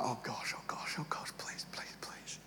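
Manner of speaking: whispered frantic pleading; panicked kid whispering in church; desperate whisper. Retold or imitated. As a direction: beg under your breath. Boy praying friend won't notice.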